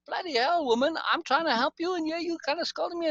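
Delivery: high-pitched exasperated retort, imitating his own annoyance; irritated outburst. To Lace, who is scolding him: high, exasperated pushback. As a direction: snap back, fed up with being scolded. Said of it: "eventually I'm like".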